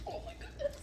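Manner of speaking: breathy high whisper of surprise; surprised whisper. Real, not acted. Owner reacting to her dogs, surprised whisper. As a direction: gasp it out in a whisper.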